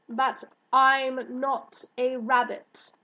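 slow, loud, emphatic protest; indignant objection. Acted. Hare to girl about rabbit shooting, indignant. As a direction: spell out an affronted correction.

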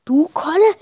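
put-on astonished priest naming the boy; surprised. A narrator's reading. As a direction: caught off guard by the confession. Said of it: "fragt Maglos überrascht".

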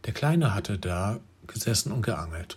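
plain reading voice

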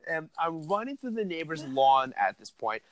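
ordinary talk

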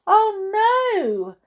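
understated horrified gasp. A narrator's reading. Mister Mean finding his nose a carrot, gasping. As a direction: gasp it in mild alarm. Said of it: "he gasped".

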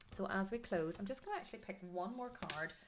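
ordinary delivery

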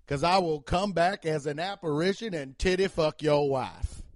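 measured, mock-solemn Southern speech; Southern cop voice. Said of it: "I do that Southern voice".

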